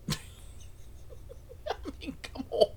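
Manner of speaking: high, breaking up with real laughter; incredulous, cracking up; laughing. Real, not acted. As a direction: squeeze the words out through laughter. Laughing at the story's absurdity.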